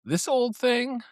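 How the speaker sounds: high, coy mock wife voice; imitating wife. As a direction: play it down like the wife with a new dress. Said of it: "my wife does this with dresses".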